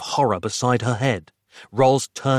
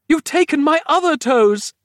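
high, loud horrified scream; screamed accusation; 5 dB louder than usual. A narrator's reading. Patient screams at the doctor who took her toes, horrified. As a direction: scream the accusation at full pitch. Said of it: "screamed Roz".

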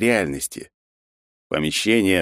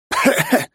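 coughing fit; 3 dB louder than usual. Acted. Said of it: "закашлялся снова".

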